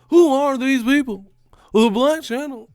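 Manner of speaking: high, loud, disbelieving yell; exasperated high-pitched outcry; incredulous shout. Pretend. Mock outrage at fighter names.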